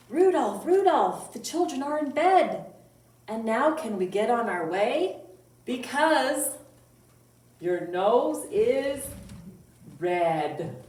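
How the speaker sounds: loud, sing-song poem reading; children's poem recital. Acted. Librarian reciting a reindeer poem to kids, loud sing-song. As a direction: chant the rhyme out for little listeners.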